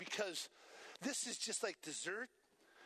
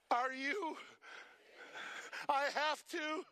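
loud, raised protest; 4 semitones higher, 4 dB louder, slower than usual. Retold or imitated. Healed man protesting to Jesus, loud protest. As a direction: push back against the order.

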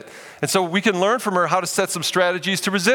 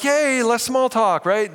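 high, slow, hammed-up yay; gleeful introvert relief; mock cheer; 8 semitones higher, much slower than usual. Introverts cheering less small talk, hammed-up glee.

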